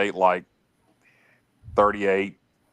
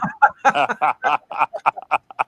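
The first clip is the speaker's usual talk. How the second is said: hearty laugh; 6 semitones higher, 5 dB louder than usual. Real, not acted. Co-host cracking up over the uniform joke, hearty laugh. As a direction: burst of real laughter, high and loud.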